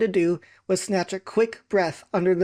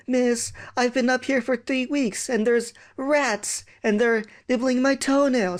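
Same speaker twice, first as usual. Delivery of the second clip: put-on whiny prisoner kid; 6 semitones higher than usual. Imprisoned kid complains.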